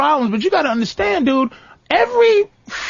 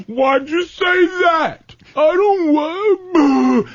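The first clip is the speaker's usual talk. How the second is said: higher, very slow mock whimper; hammed-up weepy whine; 6 semitones higher, much slower than usual. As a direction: drag it out like a sulking man. Mocking whiny self.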